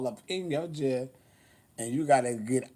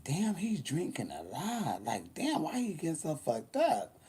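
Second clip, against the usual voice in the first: inner thought; 8 dB quieter than usual. Retold or imitated. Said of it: "I kept thinking".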